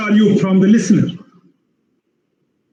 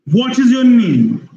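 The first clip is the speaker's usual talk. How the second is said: slow question with a long drop through "your name"; falling tone; 4 semitones higher, slower than usual. Shown as a demonstration. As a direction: draw out "name" as it falls. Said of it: "So falling tone".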